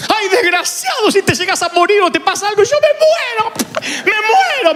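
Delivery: very high wailing mock mother. Mother clings to her found son, high wailing mother. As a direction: wail it like a dramatic mom. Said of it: "la de mi mamá".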